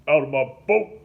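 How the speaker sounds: loud, furious order; shouted command to get out; angry command. A narrator's reading. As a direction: throw him off the boat, shouting. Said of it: "Link commanded".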